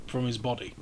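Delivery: ordinary delivery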